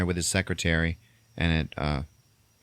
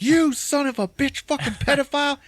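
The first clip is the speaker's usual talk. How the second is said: high, loud acted fury; enraged yell; 4 dB louder than usual. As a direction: explode at a rival in mock rage. Enraged Billy Bob attacks Santa.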